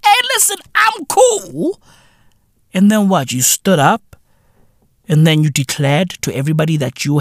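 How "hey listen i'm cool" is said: mock woman. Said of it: "This chick was like".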